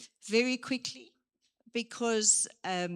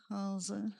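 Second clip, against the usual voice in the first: flat mumbled greeting; 8 dB quieter than usual. Shown as a demonstration. Trainer showing a flat greeting to a group, quiet and dull. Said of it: "comes into your space and says".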